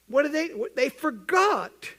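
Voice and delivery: slow, stretched-out disbelief; slow, disbelieving emphasis; incredulous. Real, not acted. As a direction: drag it out in amazement. Incredulous at the spies.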